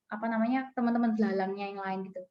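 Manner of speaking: usual speaking voice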